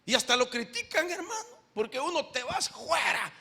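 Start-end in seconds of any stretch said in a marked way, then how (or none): 2.3-3.3 "fuera" said as "juera"; slow, raised command with an h-like J starting "fuera"; mispronounced command. Acted. Exorcist ordering a spirit out, "fuera" mispronounced as "juera". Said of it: "hasta lo corrigen, "No se dice fuera".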